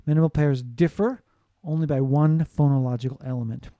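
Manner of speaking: normal talking voice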